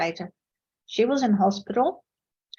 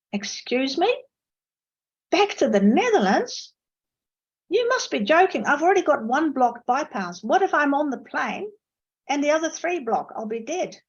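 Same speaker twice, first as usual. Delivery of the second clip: indignant outrage; 5 semitones higher than usual. Retold or imitated. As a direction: protest at the doctor, outraged. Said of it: "This whole argument erupted".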